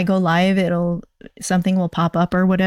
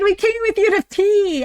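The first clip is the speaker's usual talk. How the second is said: high, squealing joy once it clicks; excited; 11 semitones higher than usual. Acted. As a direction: shout it out delighted. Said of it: "I'm so excited".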